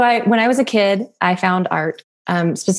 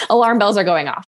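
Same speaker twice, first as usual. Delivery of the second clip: usual speaking voice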